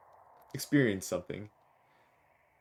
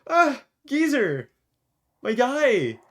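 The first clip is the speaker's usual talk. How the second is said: high, loud delighted cry to a friend; squealing hello, much higher and louder; excited greeting; 13 semitones higher, 7 dB louder, slower than usual. Acted. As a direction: call out like you just spotted your buddy. Greeting the game character excitedly.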